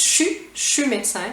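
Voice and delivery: "je suis" said as just "chu"; one short syllable "chu" for "je suis", then with "médecin"; Quebec contraction. Shown as a demonstration. Teacher isolating the short form, contracted pronunciation. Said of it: "hein, juste".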